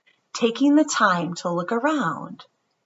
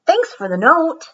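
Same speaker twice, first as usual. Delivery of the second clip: put-on child voice; 9 semitones higher, 6 dB louder than usual. Child voiced in read story.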